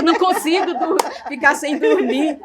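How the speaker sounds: breaking into a laugh mid-word. Retold or imitated. Retelling her prayer about losing sleep, laughing. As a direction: laugh through the complaint to God.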